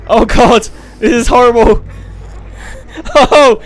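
high loud panicked cries; panicked shouting. Real, not acted. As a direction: shriek while being chased. Chased up the wall.

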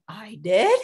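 mock surprise, rising into a question; light retold disbelief, pitch swooping up at the end; surprised reaction. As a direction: imitate someone pleasantly caught off guard. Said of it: "they're like".

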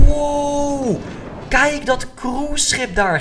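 high, slow amazed wow. Real, not acted. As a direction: gasp at the view. Sudden view from the coaster.

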